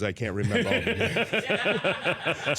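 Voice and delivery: slow, laughing Shaq answer; laughing quote. Retold or imitated. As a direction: let the laugh break into the line. Shaq's funny answer.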